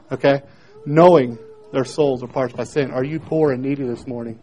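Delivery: ordinary talk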